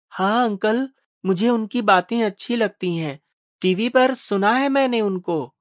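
put-on high-pitched little boy's voice; put-on child voice, pitched well up; young boy. A narrator's reading. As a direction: ask like an eager child. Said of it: "Mishra जी का छोटा बेटा आदित्य".

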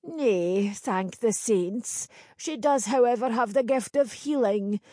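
put-on knowing old village crone. Crone character voice.

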